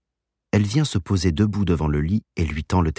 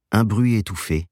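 usual speaking voice

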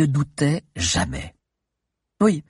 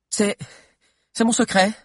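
high-pitched, stammered reply; flustered; 10 semitones higher than usual. Acted. Adviser caught off guard by the question, flustered. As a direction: stumble on the start, pitch jumping up. Parut un instant déstabilisée.

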